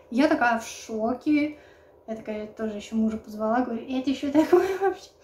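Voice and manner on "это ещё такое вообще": shocked. Retold or imitated. Her shocked question to husband.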